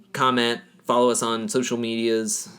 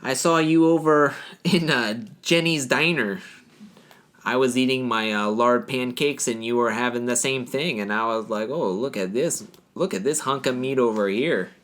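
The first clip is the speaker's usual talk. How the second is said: put-on North Dakota accent. Local admirer recalls spotting someone at Jenny's Diner, mock North Dakota accent. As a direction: play a smitten Minnesotan diner regular in accent. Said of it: "gonna talk like this in the accent".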